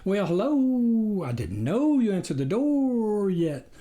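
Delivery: a hound politely greeting the couple; baying creature voice. A narrator's reading. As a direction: let the words bay like a hound. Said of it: "weird kind of baying quality".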